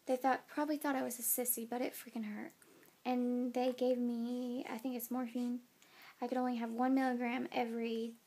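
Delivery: ordinary talk, nothing marked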